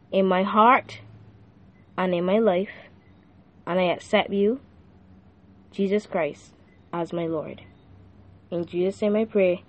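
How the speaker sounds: quiet prayer. Acted. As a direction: lead the listener in a hushed prayer. Leads the sinner's prayer.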